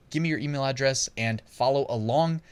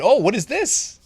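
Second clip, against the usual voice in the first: higher and louder, a playful double take; mock surprise; 9 semitones higher, 6 dB louder than usual. Real, not acted. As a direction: act surprised at what pops up on screen. Spots niche two repeated on screen.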